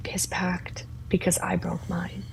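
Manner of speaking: hushed confiding whisper; a soft whispered confession. Acted. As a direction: breathe it to her, barely voiced. Confides a tender secret.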